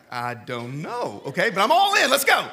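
unsure at first, then all in and loud; hesitant then eager. Retold or imitated.

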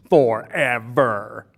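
slow, loud, triumphant scripture climax; booming proclamation. Acted. Preacher proclaims a scripture promise to the church, booming. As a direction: draw it out long, loud and emphatic.

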